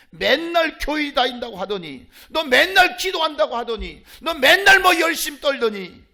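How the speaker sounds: scornful tirade, rising in force; mock jeering. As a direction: imitate a scoffer piling up accusations. Acted taunts at churchgoer, building.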